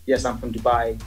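usual speaking voice

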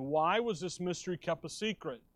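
ordinary delivery

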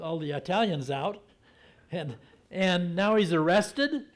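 normal talking voice

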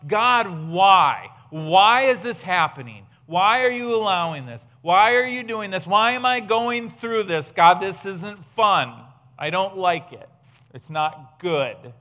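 loud pleading questions, slowing at the end; anguished cry to God. Retold or imitated. Retelling her prayer of complaint to God, loud and pleading. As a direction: cry out why at God in frustration. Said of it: "there's times when I've gone".

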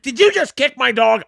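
high, outraged woman's voice; indignant dog owner. Retold or imitated. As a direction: play the shocked owner. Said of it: "the lady was like".

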